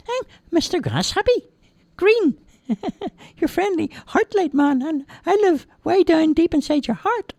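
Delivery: very high squeaky little voice; chirpy tiny elf; tiny man voice. Acted. Tiny man greets crying Roger, squeaky high.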